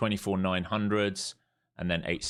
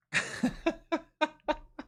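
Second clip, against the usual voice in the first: laughing. Real, not acted. Trader sees missed trade.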